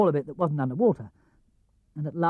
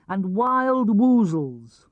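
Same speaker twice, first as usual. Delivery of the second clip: drawn-out eerie; 2 semitones higher, 4 dB louder, much slower than usual. A narrator's reading. Dreamy drawn-out creature name.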